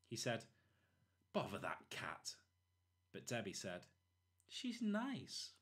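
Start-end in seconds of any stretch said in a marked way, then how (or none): 1.3-2.4 hushed, breathy grumble under the breath; whispered grumble. Acted. Mr Thomas grumbles at cat. 4.5-5.5 put-on sweet little girl defending the cat.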